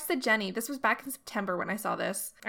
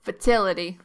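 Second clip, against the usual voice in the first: imitating a New York accent on "Fertility"; mock New York "Fertility"; 3 semitones lower, 8 dB louder than usual. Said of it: "your whole New York accent came out".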